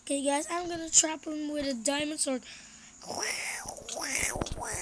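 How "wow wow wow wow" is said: whispering while hiding. Real, not acted. Sneaking up on another player.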